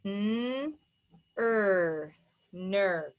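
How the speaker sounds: hummed "n", then "er", then blended "ner"; segmented phonics demo of ner; tapped out. Shown as a demonstration. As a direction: sound out N, ER separately, then join as NER. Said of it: "Let's write it".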